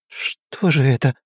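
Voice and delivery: slow, drawn-out moan of dismay. A narrator's reading. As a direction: moan it out slowly. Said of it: "простонала она".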